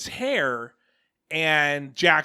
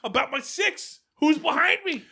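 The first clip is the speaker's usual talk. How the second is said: mock gamer yell; 10 semitones higher, 5 dB louder than usual. Imitating himself gaming, yelling at wife.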